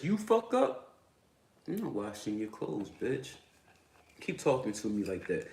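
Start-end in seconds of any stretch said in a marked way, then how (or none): 0.0-3.3 mock wife retort. Mocking a wife's defiant retort.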